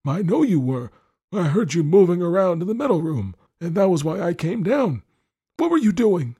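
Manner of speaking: put-on high, loud, fretful old husband; scolding old lord. Lord scolds wife about his medals, suspicious.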